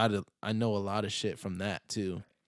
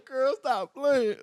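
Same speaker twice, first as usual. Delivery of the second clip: mock crying; 4 dB louder, slower than usual.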